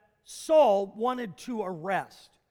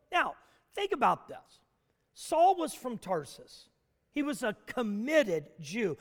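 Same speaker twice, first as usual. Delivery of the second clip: ordinary talk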